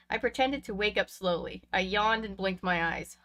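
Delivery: ordinary delivery, nothing marked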